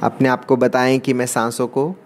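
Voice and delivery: guided meditation. Acted. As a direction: draw out key words to pace each breath. Leads guided meditation breathing round. (धीमे, शब्दों को खींचते हुए ध्यान का निर्देश)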